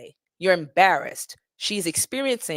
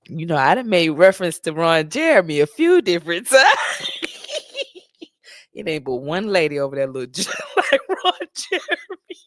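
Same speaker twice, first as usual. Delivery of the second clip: talking through real laughter; laughing; 5 dB louder than usual. Real, not acted. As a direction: crack up mid-sentence at your own joke. Cracking up at own joke.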